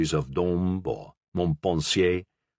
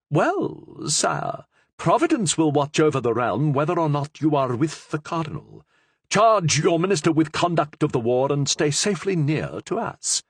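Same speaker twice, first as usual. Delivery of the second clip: higher, lightly voiced queen mother, coaxing; queen mother's voice; 7 semitones higher than usual. A narrator's reading. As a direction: plead as the king's mother, a little higher.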